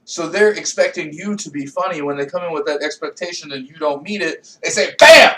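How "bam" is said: sudden high shouted bam; explosive mock shout; loud bam. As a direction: slam it out like a punch. Audience knocking the comic down.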